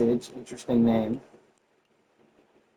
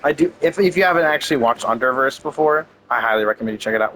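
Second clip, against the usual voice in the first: ordinary talk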